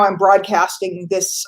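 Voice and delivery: ordinary talk, nothing marked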